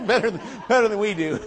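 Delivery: high, loud words through a laugh. Real, not acted. Telling the church about losing to God, laughing. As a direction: laugh it out while admitting defeat.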